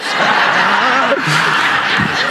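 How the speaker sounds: short real laugh; a burst of laughter at the memory. Real, not acted. Preacher laughing while telling the story, real laugh.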